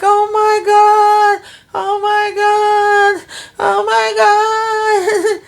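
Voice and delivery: very high, slow, drawn-out wailing sob; mock sobbing wail. Imitating Andy crying over her new penthouse, drawn-out high wail. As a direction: stretch each word into a hammed-up cry.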